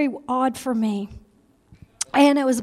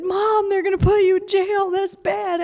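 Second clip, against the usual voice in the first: put-on higher little-girl plea; retold childlike wail, mildly done; imitating upset children; 6 semitones higher than usual. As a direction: voice a worried child calling to Mom. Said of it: "Some of my girls were crying".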